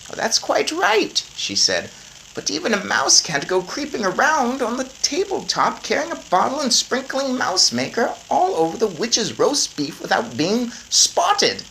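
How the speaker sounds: high, brisk grandmother voice. A narrator's reading. Grandmother planning with her grandson, high brisk voice. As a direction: plan it out like a determined granny.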